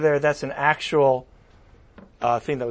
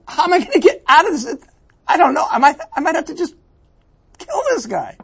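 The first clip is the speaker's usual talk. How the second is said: panicky Pilate; 12 semitones higher, 4 dB louder, faster than usual. Acted. Voicing Pilate's inner panic.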